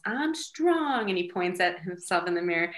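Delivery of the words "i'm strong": put-on high sing-out child's echo; little son's affirmation. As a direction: repeat it proudly like a small boy. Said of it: "So now he says".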